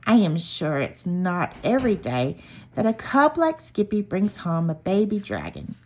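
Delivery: usual speaking voice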